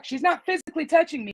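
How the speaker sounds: normal talking voice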